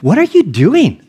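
mock incredulous demand, voice swooping high; exasperated wife. Wife questions her emotional husband during labor, mock exasperated.

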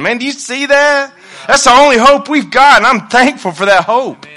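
high, loud preacher's proclamation; full-force preaching. Acted. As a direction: preach it out with rising fervour.

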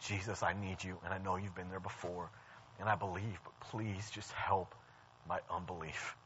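low, hushed appeal to Jesus; soft prayer. Retold or imitated. Skeptic coming before Christ for help, quiet heartfelt prayer. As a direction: pray it softly, slowing on the plea.

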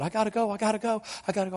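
high-pitched, repeated insistence; retold panicky refusal. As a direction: imitate someone frightened and desperate to leave. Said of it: "She is scared to death".

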